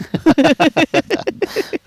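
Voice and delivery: big, high, loud laugh. Real, not acted. Commentator cracking up at co-host, loud and high.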